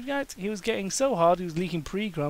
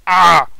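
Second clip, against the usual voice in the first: cry of pain; 3 semitones higher, 18 dB louder than usual. Acted.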